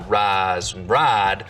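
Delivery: "rise" and "ride" with a long flat "ah"; smoothed I. Shown as a demonstration. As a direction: flatten the I in "ride" to "rahd". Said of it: "smooth the diphthong".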